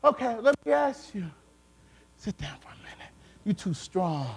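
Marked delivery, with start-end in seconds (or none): 2.2-4.3 low, very soft tempter's murmur; hushed, low persuasion; coaxing whisper. Acted. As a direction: whisper it like a sly inner voice. Voices the force saying stay down.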